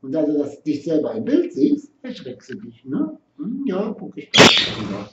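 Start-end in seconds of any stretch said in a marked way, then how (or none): none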